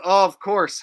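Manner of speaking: ordinary talk, nothing marked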